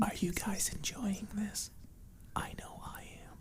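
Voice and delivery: breathy close-mic ASMR whisper; teasing the podcast listeners in an ASMR whisper. Pretend. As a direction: whisper right into the listener's ear holes, ASMR style. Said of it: "It's almost like A S M R".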